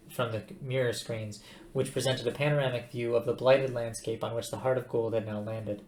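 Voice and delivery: ordinary delivery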